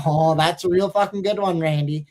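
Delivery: lightly voiced nasally jab. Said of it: "He has that super nasally voice".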